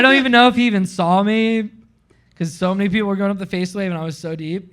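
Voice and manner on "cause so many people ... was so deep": ordinary talk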